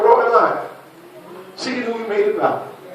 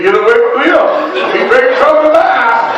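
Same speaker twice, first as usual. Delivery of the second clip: louder, higher mock yell at the kid; half-joking raised voice; mock shout; 7 semitones higher, 7 dB louder than usual. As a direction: shoo him off with a playful yell. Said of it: "I'm gonna say".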